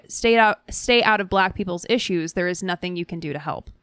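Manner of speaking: ordinary talk, nothing marked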